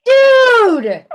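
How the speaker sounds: high, loud, stretched-out whoop; super excited. Retold or imitated. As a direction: holler it, hyper and thrilled. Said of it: "super excited about stuff".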